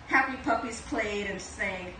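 plain reading voice

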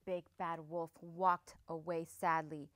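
low, soft, sorrowful telling; downcast narration. A narrator's reading. Narrator on the wolf walking away rejected, downcast. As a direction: let the narration sink sadly. Narrator tells the wolf's dejection.

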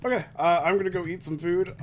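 ordinary delivery

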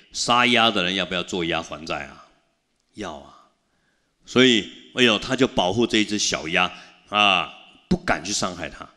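ordinary talk, nothing marked

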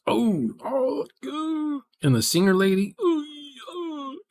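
high, gushing little cries of delight; excited squeals. Real, not acted. Fan gushing over Goose and the singer, excited high squeals.

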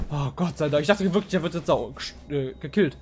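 usual speaking voice

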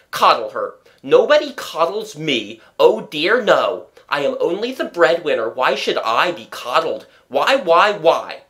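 loud, aggrieved rant; shouting. A narrator's reading. Breadwinner jealous of the coddled dog, shouting. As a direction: rant with wounded self-pity, pitch swooping. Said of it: "he shouted".

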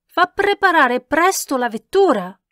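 high, loud, imperious command; shouted order. A narrator's reading. Countess orders the carriage from her companion, shouting. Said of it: "gridò la contessa".

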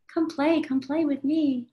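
put-on high, sing-song child's plea; pleading child. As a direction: beg sweetly like a little child. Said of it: "inner child tugs at the heartstrings".

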